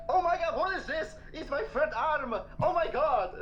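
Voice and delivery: mock shocked kid. A grown Jedi kid freaking out at his body, high-pitched. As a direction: squeal like a kid finding something alarming. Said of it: "be like".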